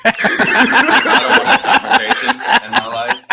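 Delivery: high, loud laughing through the words. Real, not acted. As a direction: laugh it out while confessing. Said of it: "laughing line".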